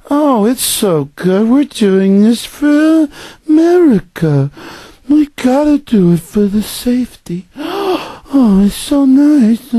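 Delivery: very high, slow, syrupy mock sweetness; fake-nice official. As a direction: gush slowly in a high, sugary put-on voice. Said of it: "They all talk like this".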